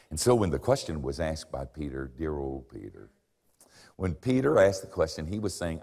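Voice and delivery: ordinary talk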